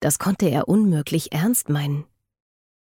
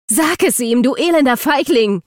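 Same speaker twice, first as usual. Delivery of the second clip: loud, high-pitched demand; angry shout; 8 semitones higher, 5 dB louder than usual. A narrator's reading. Eden confronts Luke after shoving him, shouting angrily. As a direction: shout the insult straight at him. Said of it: "rief sie".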